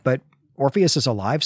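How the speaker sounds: ordinary delivery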